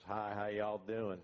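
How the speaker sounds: mock Texan host, slow and loud; Ross's drawl. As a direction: drawl it out like a genial Texan tycoon. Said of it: "Ross comes in, says".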